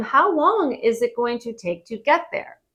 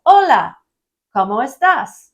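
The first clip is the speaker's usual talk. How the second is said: bright greeting; 3 semitones higher, 7 dB louder than usual. Pretend. Friendly greeting to resort workers.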